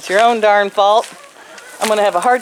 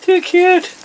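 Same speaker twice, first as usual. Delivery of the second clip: high cooing; 11 semitones higher than usual. Real, not acted. Cooing over bunnies.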